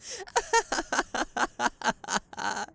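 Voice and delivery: long scornful laugh at the hero; mocking villain laugh. Villain laughing at the hero's powers. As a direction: laugh off his so-called powers.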